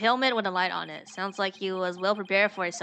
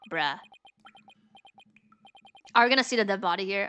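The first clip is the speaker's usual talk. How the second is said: usual speaking voice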